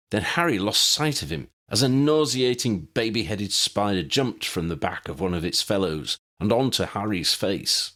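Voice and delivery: usual speaking voice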